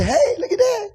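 high laughing jeer; mocking bystander. Passerby laughing at a gutted man, high and mocking.

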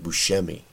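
"Buscemi" with sh, boo-SHEM-ee; alternative pronunciation. Shown as a demonstration. Host trying another version, "Buscemi" said with sh. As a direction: say "Buscemi" with a hushing sh in the middle. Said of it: "or is it Buscemi".

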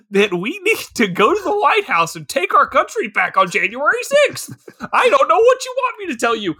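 high, exasperated hammed-up ranting; mock conspiracy crank. Conspiracy believer to police, mock desperate rant. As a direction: build to a squeaky, strung-out finish.

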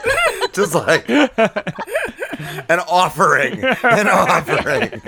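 slow, laughing delight. Real, not acted. Laughing at the husband's sneaky wine offering, slow and amused.

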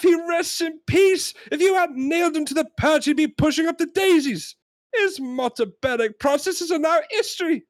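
high-pitched exasperated rant; hammed-up outrage, every phrase hammered out high; John Cleese yelling. Customer ranting that the parrot is dead, exasperated high yell. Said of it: "and he yells a lot".

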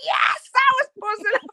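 very high, squealed fan triumph; elated cheer. Retold or imitated. Fan reliving the star's reply, high excited squeal. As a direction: burst out in giddy celebration. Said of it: "I was going".

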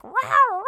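high loud playful roar; kid dinosaur roar. Retold or imitated. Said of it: "looking in the mirror and being like".

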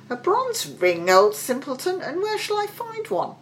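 high, scoffing question from a servant; incredulous echo. A narrator's reading. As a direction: echo it in disbelief, rising high. Slave echoes the price, incredulous.

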